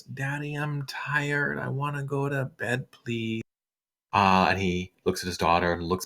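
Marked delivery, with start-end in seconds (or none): 0.0-3.4 put-on higher, softer child's voice; a tired child tugging at her daddy; sleepy little girl. Daughter Leaf asking her father for bed, soft and high. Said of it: "Leaf goes".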